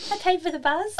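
a hint of the daughter piping up; daughter's voice. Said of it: "she was like".

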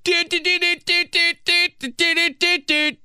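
high, loud sung doots like a car lock chirp; car lock beeps. Retold or imitated. Locking the car, mimicking its chirping beeps. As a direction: chirp each doot like an electronic beep. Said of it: "But I'm always like".

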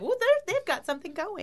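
high squeaky voice. Retold or imitated. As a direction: squeak it out playfully. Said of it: "I was like".

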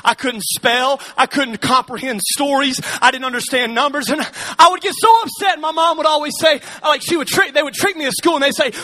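normal talking voice

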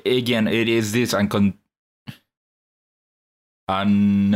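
ordinary talk

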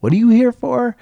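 puzzled guest. Retold or imitated. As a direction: play a baffled guest asking. Puzzled guests questioning concierge.

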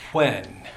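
'When' with a puff of air through pursed lips. Shown as a demonstration. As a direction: blow 'When' out with a breathy wh. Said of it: "a puff of air coming through pursed lips".

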